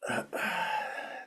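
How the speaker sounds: soft, audible exhale; weary sigh. Real, not acted. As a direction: sigh over a crazy morning. Sigh about a crazy morning.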